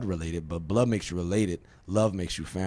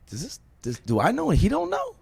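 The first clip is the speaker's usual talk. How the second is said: much higher, louder puzzled question to himself; baffled; 5 dB louder than usual. Retold or imitated. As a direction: sound totally confused by the message. Puzzled reading a text message.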